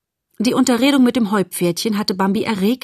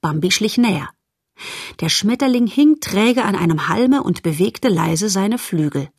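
ordinary delivery

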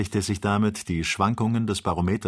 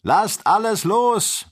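high, loud, drawn-out order; shouted command; 13 semitones higher, 7 dB louder, slower than usual. Acted. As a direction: call the order slowly across a distance. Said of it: "rief der Doktor".

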